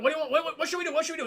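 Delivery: high, loud frantic stammering; hammed-up panicked questions tumbling out; mock panic. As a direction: act out someone losing their head. Acted panic in film scene.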